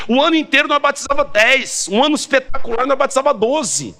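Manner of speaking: high-pitched, driving pulpit delivery; impassioned sermon cry; fervent preaching. Real, not acted. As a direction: preach it hard, stretching the key phrases. Preaches at full force.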